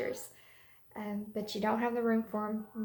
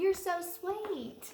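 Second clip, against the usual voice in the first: tender praise; 8 semitones higher, much slower than usual. Real, not acted. Praising her little helper son.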